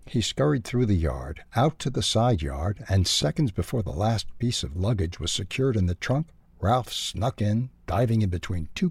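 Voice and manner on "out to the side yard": plain reading voice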